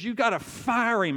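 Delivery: angry trainer pushes the owner to fire him; retold angry demand. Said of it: "he was so angry".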